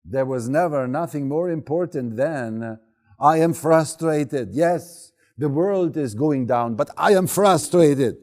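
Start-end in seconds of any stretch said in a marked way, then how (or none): 3.2-4.5 louder, higher and slow, stressing I; slow, loud mock child insisting "I am frustrated"; insistent daughter. Daughter voiced emphatically. 4.5-8.2 put-on louder, higher child insisting; insistent daughter. Daughter voiced emphatically.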